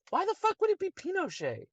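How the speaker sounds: very high-pitched disbelief; incredulous repeat. Real, not acted. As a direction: shoot the voice way up in exasperation. Repeats question, now incredulous.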